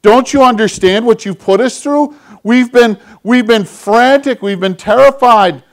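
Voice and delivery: high, loud, worried mother scolding her lost son; frantic mother. Retold or imitated. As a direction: play the panicked mom who finally found her boy. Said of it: "his mom looks at him and he goes".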